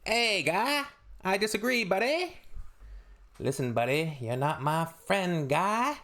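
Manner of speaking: exaggerated Canadian accent, passive-aggressive buddy voice; mock Canadian politeness. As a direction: lampoon a Canadian arguing politely but angrily. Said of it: "Canadian politics is".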